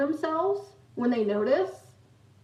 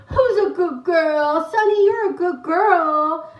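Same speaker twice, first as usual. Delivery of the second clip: loud, high, drawn-out pet talk; dog-praising voice; 6 semitones higher, 8 dB louder, slower than usual. Retold or imitated. Sisters praising the dog to annoy mom, exaggerated pet talk. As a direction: gush at the dog, exaggerated and teasing.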